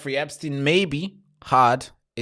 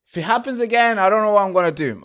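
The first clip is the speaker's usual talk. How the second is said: higher, louder, fast exasperation; agitated rant; 8 semitones higher, 7 dB louder, faster than usual. Real, not acted. Streamer venting fast and louder about the broken stream. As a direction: vent fast, fed up. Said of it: "I'm really agitated right now".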